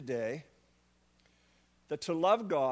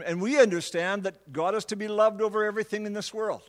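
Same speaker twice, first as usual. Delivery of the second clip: ordinary talk, nothing marked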